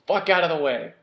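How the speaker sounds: irritated guard barking at the party; grumbling guard. Acted. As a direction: shove past them with a grumble. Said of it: "he kinda grumbles to y'all".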